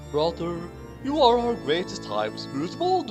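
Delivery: ordinary talk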